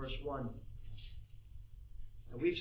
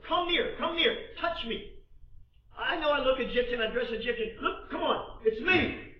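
high, loud, insistent appeal; eager urging; 8 semitones higher, 8 dB louder than usual. Acted. Joseph urging his stunned brothers closer, eagerly insistent. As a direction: coax them to come and recognise you.

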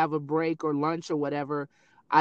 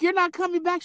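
incredulous; 13 semitones higher than usual. Retold or imitated. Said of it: "I'm like".

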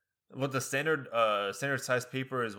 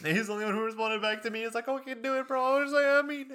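giddy laughing; 11 semitones higher, faster than usual. Retold or imitated. Excited high laughing retelling.

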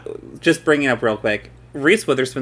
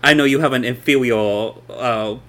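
put-on Boston accent, laughing; 5 dB louder than usual. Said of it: "the Boston voice".

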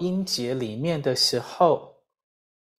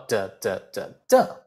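four beats of 的, punch the final one; stress on fourth syllable; 7 semitones lower than usual. Shown as a demonstration. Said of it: "第四叫的".

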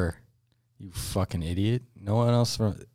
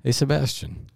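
higher, stagey polite exchange; mock formal skit; 8 semitones higher than usual. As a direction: play it like a cheesy scripted intro. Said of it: "You're gonna ask me for a quote".

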